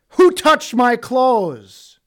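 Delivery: loud, slow, searching call to the crowd; Jesus calling out. Acted. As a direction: call it out slowly, demanding who touched him.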